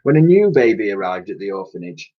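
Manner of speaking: plain reading voice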